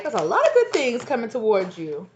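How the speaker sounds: high, loud delighted announcement; excited spirit message. Acted. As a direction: relay good news with a rising burst of energy. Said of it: "They're like".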